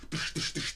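voiceless, repeated percussive tish noises; quick soft hammering sound effect; imitated hammer blows. Shown as a demonstration. Teacher showing nails punching a pot, imitated hammer blows.

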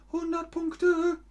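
playful high-pitched echo; squeaky echo. Pretend. Teacher echoes praise playfully.

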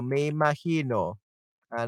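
ordinary talk

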